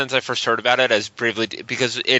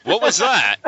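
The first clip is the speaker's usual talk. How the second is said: bad acting; 5 semitones higher, slower than usual. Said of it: "this acting is terrible".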